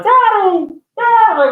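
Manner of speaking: put-on high, loud little girl crying daddy; high child voice calling out. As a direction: call out daddy like a scared child. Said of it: "I hear, like".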